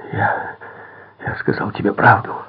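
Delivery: high, halting moan. A narrator's reading. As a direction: stammer out a pleading groan. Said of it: "простонал он".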